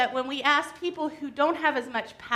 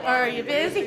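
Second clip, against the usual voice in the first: normal talking voice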